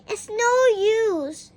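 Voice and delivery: slow, sighing and high; a weary little sigh of defeat; a sighing girl's voice. A narrator's reading. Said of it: "she sighed".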